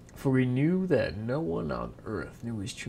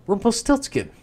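loud exclamation; 7 semitones higher, 10 dB louder than usual. Acted. Queen discovers the name.

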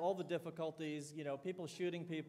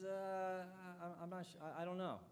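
imitating a stumped athlete, much softer; blank and unsure; 6 dB quieter than usual. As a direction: falter quietly, searching for an answer. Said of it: "looks at me with a blank look".

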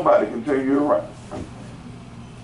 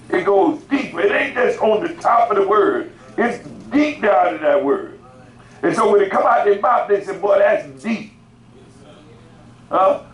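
high-pitched, insistent pulpit delivery; raised-voice preaching; 8 semitones higher than usual. Real, not acted.

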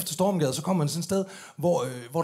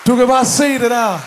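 demonstration loud; 6 semitones higher, 8 dB louder than usual. Shown as a demonstration. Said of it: "han gør sådan her".

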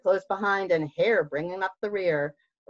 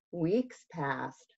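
ordinary delivery

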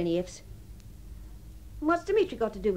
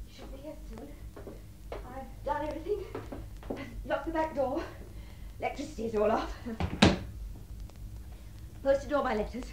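very soft talk to oneself; hushed, halting soliloquy; subdued waiting; 8 dB quieter than usual. Acted. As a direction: murmur a checklist privately, with pauses. Alone, waiting for her lover.

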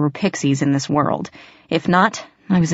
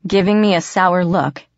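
usual speaking voice

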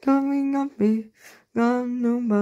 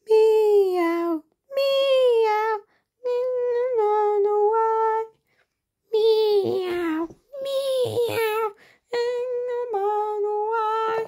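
much higher, sing-song kitten mewing and wailing; crying kitten; 12 semitones higher, slower than usual. Acted. Child acting the lost kittens in the rhyme, high crying mews. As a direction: mew like a sad little lost kitten.

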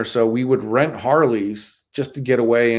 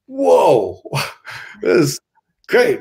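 excited whoa; 6 semitones higher, slower than usual. Retold or imitated. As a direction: relive the moment the bikes roll out. Stuntmen see the Harleys.

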